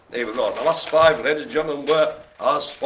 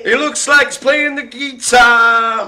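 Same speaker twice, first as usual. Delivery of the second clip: loud showman remark; 8 semitones higher, 9 dB louder than usual. Acted. Excited showman commentary.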